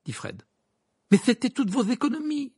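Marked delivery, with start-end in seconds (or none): 1.1-2.5 high-pitched dismayed outburst; shocked protest. Acted. Ron to the twins about lost savings, shocked. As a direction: protest in alarm, pitched well up.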